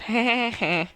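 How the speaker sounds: loud, high imitation cackle; Janice laugh. Friend spicing up the subliminals with an imitated laugh. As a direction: throw in a mock sitcom laugh. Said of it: "I'll throw in a Janice laugh".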